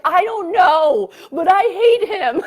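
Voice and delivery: high, loud and slow, in an altered voice; slow hateful declaration. Retold or imitated. Telling her friend how she answered her husband, slow, loud and high. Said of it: "I'm like".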